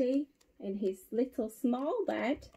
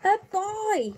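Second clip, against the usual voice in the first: high-pitched storybook yelp; mock yell; 8 semitones higher than usual. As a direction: yell it high, without full force. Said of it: "yelled the bear".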